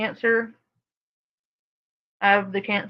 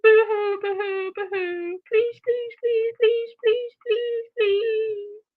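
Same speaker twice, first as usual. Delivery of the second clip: high, begging mock whine; 11 semitones higher than usual. Streamer imitating the mother begging viewers for money, high and whiny. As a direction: plead like a pathetic beggar. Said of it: "she comes on here".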